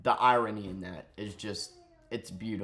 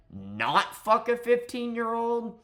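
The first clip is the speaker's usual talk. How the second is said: much higher, slow, sarcastic; high mock voice; 11 semitones higher, 4 dB louder, slower than usual. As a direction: squeak it out in mockery. Mocking the streamers.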